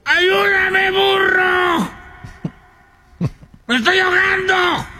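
high shouted plea; drowning ant shouting high; ant's cry. Acted. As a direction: scream for help up high. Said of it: "Y la hormiga miró al burro".

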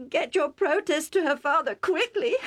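mother urging her husband in distress; sobbing plea. Acted. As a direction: beg through tears. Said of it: "Rebecca sobbed".

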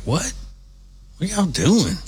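mock disbelief; incredulous kid self, imitated. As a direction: act the puzzled child catching them.

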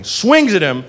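ordinary talk

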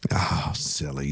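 soft breathy whisper. Real, not acted. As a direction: drop to a whisper. Mocking the useless watch.

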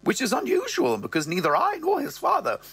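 high, theatrical mother voice; mother's voice. Acted. Mother complaining about the father, high voice. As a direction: pitch it way up as the mother. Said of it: "she goes".

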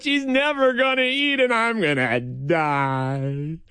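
mock-despairing moan, last word stretched; dramatic despair.

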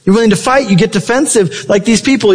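usual speaking voice

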